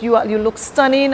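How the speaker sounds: ordinary talk, nothing marked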